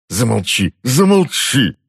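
angry bark, rising on the repeat; irate uncle cutting her off. A narrator's reading. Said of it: "рявкнул дядюшка".

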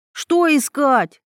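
high, drawn-out urgent question; demanding, stretched-out question; insistent boy's outcry. A narrator's reading. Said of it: "выкрикнул он вдруг".

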